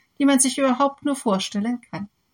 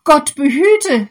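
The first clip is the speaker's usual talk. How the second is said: loud, higher exclamation of refusal; horrified cry; 6 semitones higher, 9 dB louder than usual. Acted. Farmer refusing to behead the snake, loud horrified cry. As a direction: cry out in shock. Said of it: "rief der Bauer".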